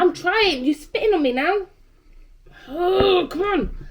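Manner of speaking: loud strained protest. Real, not acted. Gagging on food, spat at.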